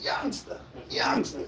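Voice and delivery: hushed breathy come-on; soft teasing call; mock cougar call. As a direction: whisper it like a lady beckoning. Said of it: "She like".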